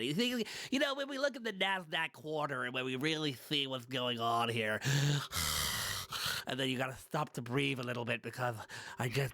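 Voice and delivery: mock American finance guru running out of air; nasal American accent. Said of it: "this really nasal kind of, like, American accent".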